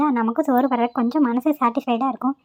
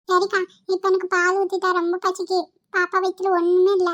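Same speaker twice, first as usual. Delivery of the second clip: put-on higher pleading baby-talk; hungry child; 5 semitones higher than usual. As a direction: whine for milk like a little boy. Child begging for milk.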